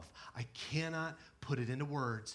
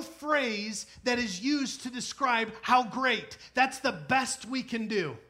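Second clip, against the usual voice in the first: louder, higher, urgent questioning; raised sermon voice; preaching; 10 semitones higher, 8 dB louder than usual. Acted. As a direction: drive the point home.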